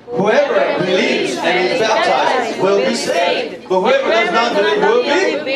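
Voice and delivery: unison scripture reading. Acted. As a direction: read it out together, slowly.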